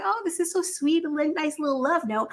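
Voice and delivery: high, soft, sugary take on her; a lightly voiced gushing girlfriend; mock sweet girl. Teller imitating Courtney finding the note, high mock sweet.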